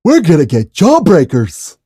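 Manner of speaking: high, loud cartoon yell; cartoon impression. Urging Double D to get jawbreakers, loud cartoon voice. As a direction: holler it in an excited high cartoon voice. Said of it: "I used to be able to do it really good".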